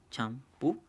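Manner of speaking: champú broken into cham, pú; split into syllables. Shown as a demonstration. Teacher dividing a second example, champú syllable by syllable. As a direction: say champú as two separate syllables with a pause between. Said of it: "en dos también".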